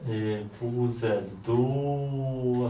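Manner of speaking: ordinary delivery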